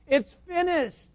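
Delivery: ordinary talk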